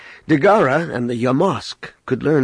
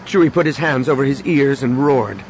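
plain reading voice

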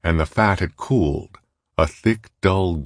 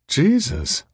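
high-pitched exclamation in a woman's character voice; exclaiming Molly; 10 semitones higher than usual. A narrator's reading. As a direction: pitch it up sharply, then let it drop. Molly exclaims at uneaten steak.

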